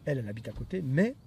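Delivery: usual speaking voice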